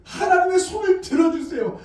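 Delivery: demonstrated desperate plea; pleading prayer. Shown as a demonstration. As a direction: cry out to God for help. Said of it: "이 말은 도와달라는 거예요".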